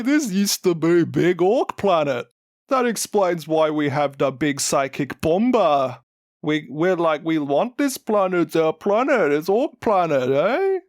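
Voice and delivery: put-on bellowing orc, half Kiwi accent; orc voice with a Kiwi twang. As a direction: play a brutish orc whose accent drifts Kiwi. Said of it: "Your orc impression got a little Kiwi there".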